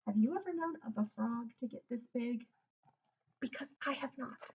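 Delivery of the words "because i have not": ordinary delivery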